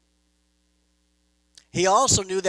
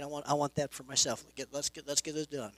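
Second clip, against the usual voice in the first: soft earnest request; 4 semitones lower, 11 dB quieter than usual. Pretend. Imagined new convert asking for baptism.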